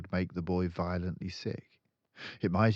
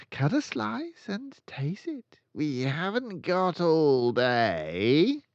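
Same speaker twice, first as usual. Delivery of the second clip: high, slow, booming headmistress; impatient tyrant; 11 semitones higher, 8 dB louder, slower than usual. A narrator's reading. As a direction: lean on every word, impatient. Trunchbull ordering the boy.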